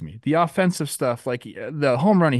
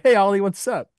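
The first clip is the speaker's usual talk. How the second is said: higher, louder breezy hello; cheery greeting; 8 semitones higher, 5 dB louder than usual. Retold or imitated. Friend imitating how he greets his son, higher and louder. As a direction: greet your kid like a buddy. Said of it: "I'll come home and be like".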